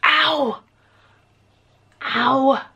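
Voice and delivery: sharp yelp of pain. Real, not acted. Piercing her ear, pain.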